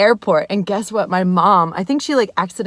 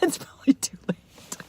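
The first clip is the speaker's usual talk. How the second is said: laughing; 4 semitones higher, 9 dB quieter than usual. Real, not acted. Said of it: "Oh, wait".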